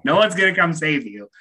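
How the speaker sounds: normal talking voice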